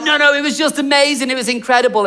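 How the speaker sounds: lightly hammed-up amazement; gushing insistence. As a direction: insist like an over-excited believer. Gushing friend in an acted argument.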